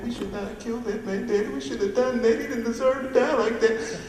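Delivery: high, panicky whimpering woman's voice; blubbering bottom girl. Acted. Bottom girl pleading with Iceberg, high and tearful. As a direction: play her as a frantic, tearful mess.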